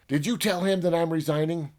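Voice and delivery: high, loud, fast demand; demanding confrontation. Retold or imitated. Scientist confronting Herbie about resigning, loud demand. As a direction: corner the robot for an answer.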